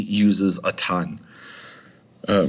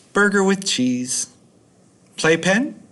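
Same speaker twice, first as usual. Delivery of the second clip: put-on high pleading kid voice; high-pitched kid asking; childish whine; 10 semitones higher than usual. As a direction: ask like an indecisive little kid. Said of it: "the kid is very indecisive".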